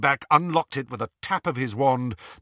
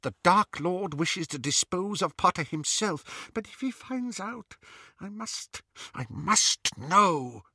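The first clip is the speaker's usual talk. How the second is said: high, fretful witch voice; fret, half to herself; anxious witch; 9 semitones higher than usual. A narrator's reading. Bellatrix anxious.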